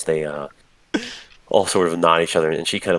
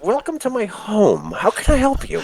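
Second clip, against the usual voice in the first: tipsy Mister Rigby. Acted. Drunk Rigby welcomes visitors to drain, high and silly.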